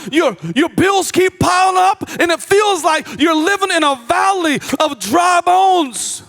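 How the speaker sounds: impassioned pulpit preaching; full-force preaching. Acted. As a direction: preach it hard to the congregation.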